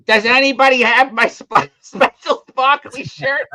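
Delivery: high, loud, drawn-out mock announcement; loudspeaker plea. Woman on store loudspeaker seeking her shirt, mock announcing. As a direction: blare it out as an obsessed customer. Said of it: "gets on the loudspeaker".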